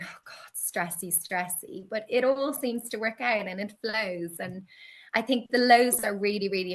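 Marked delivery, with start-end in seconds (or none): 0.0-1.9 whispered, much softer mock stress. Retelling a foggy stressed-out week, whispered fretting. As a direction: breathe it out as a fretful whisper. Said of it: "fog for a couple of days".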